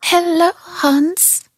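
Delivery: very high, tiny mushroom voice; a tiny mushroom calling up to Hans; delicate little voice. A narrator's reading. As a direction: squeak it up small and pleading. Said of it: "a delicate little voice calling".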